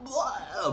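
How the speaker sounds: high mock gag; mock retch. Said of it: "and she's just like".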